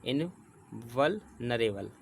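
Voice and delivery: इनवल्नरेबल slowly as in, val, nar-able; teacher breaking इनवल्नरेबल into chunks; divided into parts. Shown as a demonstration. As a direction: pause after "in" and "val" in इनवल्नरेबल. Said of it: "चार part में divide कर लेते हैं".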